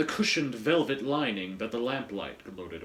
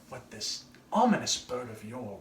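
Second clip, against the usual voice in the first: usual speaking voice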